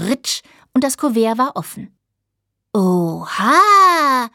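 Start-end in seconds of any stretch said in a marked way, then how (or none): 2.7-4.3 loud, drawn-out awe; big admiring gasp of a word; admiring exclamation. A narrator's reading. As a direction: call it out in wonder, stretching the end. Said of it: "riefen die Krauses bewundernd".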